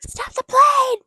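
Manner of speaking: high, loud desperate yell; hammed-up scream. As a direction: scream it at the plane. [name] yelling again, louder.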